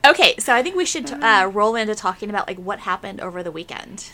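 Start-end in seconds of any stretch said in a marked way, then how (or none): 2.5-4.1 whisper. Real, not acted. Whispered tease.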